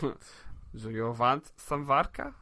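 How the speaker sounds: put-on slow, low, soft Russian accent; mock Russian accent. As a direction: Sean Connery doing a Russian, drawn out. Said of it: "Sean Connery with a Russian accent".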